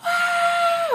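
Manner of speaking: high, loud long shrieks; drawn-out mock crowd scream; screaming audience. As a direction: imitate fans going wild. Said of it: "for the audience to stop screaming".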